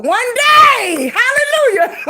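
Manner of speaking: high, drawn-out jubilant holler; mock praise shout. Woman joking about getting skinny someday, mock-jubilant shout. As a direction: cry it out like a joking hallelujah.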